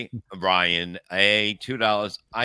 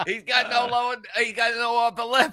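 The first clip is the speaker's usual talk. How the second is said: worked-up protest; 13 semitones higher, 8 dB louder than usual. Real, not acted. Co-host mocking the duck lip pose, loud high protest. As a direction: cry it out high and long.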